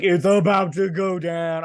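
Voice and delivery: loud, high, stretched-out holler; hyped reaction. Retold or imitated. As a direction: egg on the fight, loud and slow.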